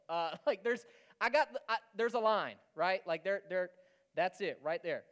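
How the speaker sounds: bubbling over while pointing it out; excited laughing. Real, not acted. Excitedly showing the line.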